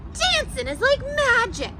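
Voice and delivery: put-on a cool cat cheering himself on, big and bright for the kids; silly Pete the Cat voice. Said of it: "my silly Pete the Cat voice".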